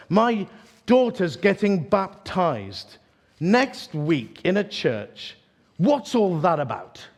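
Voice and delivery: slow, emphatic, rising demand; fiery challenge. Retold or imitated. Passenger challenging the preacher on the plane, slow and fiery. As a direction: hammer out each phrase with fire. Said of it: "with fire in her eyes and said".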